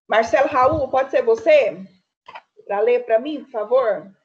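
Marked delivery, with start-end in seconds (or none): none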